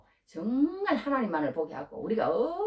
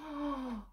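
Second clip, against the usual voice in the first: a quick, high intake of breath; sharp gasp; 7 semitones higher, 10 dB quieter than usual. Acted. Preacher gasps, struck by God's word.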